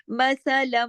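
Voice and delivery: मसलम with the closing m held as a long hum; steady nasal hum ending मसलम; nasal ghunnah. Shown as a demonstration. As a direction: linger on the m of मसलम through the nose. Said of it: "अम की आवाज है ये गुन्ना है".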